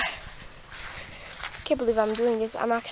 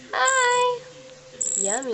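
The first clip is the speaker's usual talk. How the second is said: ordinary talk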